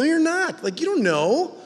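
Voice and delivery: normal talking voice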